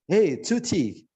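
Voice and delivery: hammed-up English-accented Indonesian. Shown as a demonstration. (logat kebule-bulean yang lebay)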